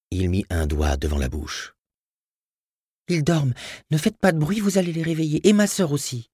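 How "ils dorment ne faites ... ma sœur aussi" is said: put-on high, thin-pitched child voice; little boy. Little boy hushes the intruder.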